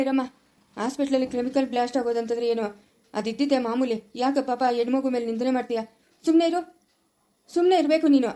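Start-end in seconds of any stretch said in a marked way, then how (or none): 6.2-8.3 sharp, louder and higher telling-off; stern rebuke. Acted. Father silencing his daughter's accusations, stern. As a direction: shut her up firmly.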